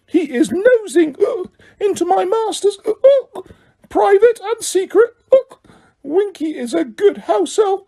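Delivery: a tipsy elf digging in her heels; Winky defiantly guarding secrets from Harry; mutinous drunk elf. A narrator's reading. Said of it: "Winky ranting drunkenly".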